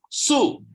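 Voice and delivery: SU starting high and dropping sharply, fourth tone; nada empat. Shown as a demonstration. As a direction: let "su" fall fast from the top. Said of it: "Nada empat". ("su" jatuh dari tinggi ke bawah)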